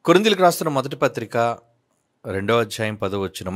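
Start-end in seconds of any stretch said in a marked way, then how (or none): none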